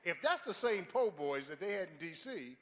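ordinary talk, nothing marked

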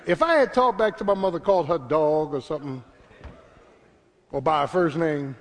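ordinary talk, nothing marked

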